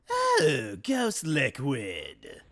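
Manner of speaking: slow, drawn-out Joker character voice. Acted. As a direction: stretch it out as Joker. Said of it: "my Joker voice".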